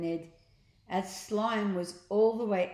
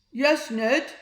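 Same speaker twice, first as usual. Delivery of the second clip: slime voice; 7 semitones higher, 10 dB louder than usual. A narrator's reading. Slime replies.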